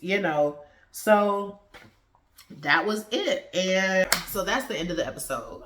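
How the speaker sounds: normal talking voice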